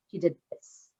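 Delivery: sudden drop to soft and low; mic drop. Shown as a demonstration. Speaker landing the climax of a story, suddenly hushed. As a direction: cut the volume right down after the build. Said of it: "ascend, mic drop".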